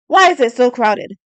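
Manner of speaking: loud booming demand; booming knight. A narrator's reading. Galloway riding at the head of the procession, booming. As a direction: boom it over the heralds. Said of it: "Galloway's voice boomed above the heralds".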